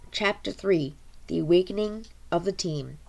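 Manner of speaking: plain reading voice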